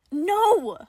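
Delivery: loud, high, flat refusal; shout. Acted. Johnny shouts back at Nicola in frustration. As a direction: shout him down. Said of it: "Johnny shouted back".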